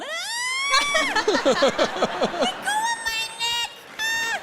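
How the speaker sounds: slow falsetto mock wail; hammed-up high squeals of helpless pain; squealing victim. Would-be rescuer now caught by the neck, squealing mock wail. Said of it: "I'm gonna be going".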